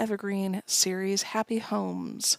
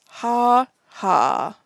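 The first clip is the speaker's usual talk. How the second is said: mocking ha ha; 3 semitones higher, 7 dB louder than usual. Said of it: "and he says".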